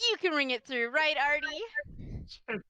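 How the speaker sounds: playful drawn-out aside to a buddy; sly clerk nudge. Acted. Clerk slyly asking his buddy to ring it up. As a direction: stretch out Artie, teasing. Player voices shop clerk to teammate.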